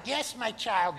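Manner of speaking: plain, gentle God; lighter God voice. Acted. Preacher suggests God sounds gentler. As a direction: say it like an ordinary kind father. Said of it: "God might sound like".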